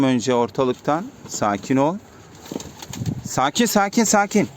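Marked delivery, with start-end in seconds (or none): 3.3-4.5 higher, coaxing repeated calm-down call. Real, not acted. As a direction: coax them, voice up a bit. Calming birds again. (kuşlara biraz tiz sesle sakin olun diye seslenme)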